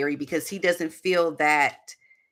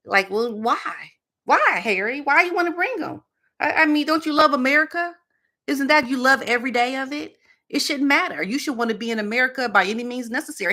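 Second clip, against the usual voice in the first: pitched way up, needling Harry with why; mock incredulous; 8 semitones higher than usual. Vlogger mockingly quizzes absent Harry about America, high incredulous voice. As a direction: teasingly grill him as if he were in the room.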